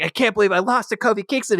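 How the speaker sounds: high, fast frantic rant; mock furious. Randy Orton raging after losing to Kofi, high fast mock fury. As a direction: rattle it off like a sore loser. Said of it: "He's going crazy".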